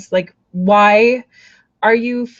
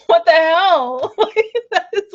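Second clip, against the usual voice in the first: higher, slow laughing what the hell; laughing disbelief; 8 semitones higher, 3 dB louder, slower than usual. Real, not acted. Reader laughing at the card's rude message. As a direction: laugh through the words.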